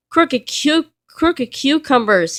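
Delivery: startled oath, slowed at the end; shouting. A narrator's reading. Mister Grumpy startled by a noise, a mild high cry. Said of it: "he shouted".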